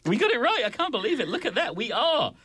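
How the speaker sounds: high-pitched, delighted disbelief; thrilled, incredulous gloating; excited celebration. Real, not acted. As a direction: crow over a win, voice way up. Celebrating a correct quiz answer.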